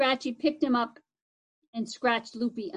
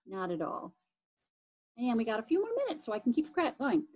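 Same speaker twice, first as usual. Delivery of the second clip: ordinary delivery, nothing marked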